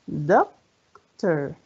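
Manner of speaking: "Doctor" split as doc, long pause, tor; syllable by syllable. Shown as a demonstration. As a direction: count out both parts of "Doctor". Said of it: "كم عدد المقاطع".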